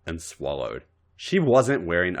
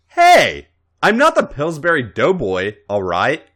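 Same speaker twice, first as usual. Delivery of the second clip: loud protest; 4 semitones higher, 6 dB louder than usual. Acted. Protesting tickle.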